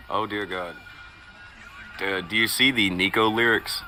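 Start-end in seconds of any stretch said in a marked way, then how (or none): none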